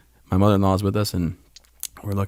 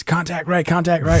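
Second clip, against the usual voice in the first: sharp, higher callout of contact right; retold combat callout; 8 semitones higher than usual. Veteran reliving the firing range, alert callout. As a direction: bark the alert as if under fire.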